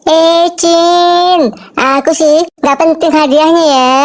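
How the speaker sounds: high, camp and breezy, stretched out; camp banci voice. Acted. Banci answering the jeering crowd, high and camp. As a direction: purr it like a flamboyant banci. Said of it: "tuh banci menjawab dengan santainya".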